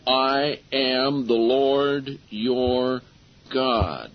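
each word drawn out slowly and weightily; slow emphatic ending. Shown as a demonstration. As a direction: lean on every word, very slow. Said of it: "Emphasizes every one of them".

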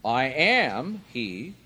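AM stressed loud, words drawn out slowly; slow declaration, leaning hard on AM; emphatic. Retold or imitated. Jesus answers the woman at the well, emphatic. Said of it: "emphatic expression".

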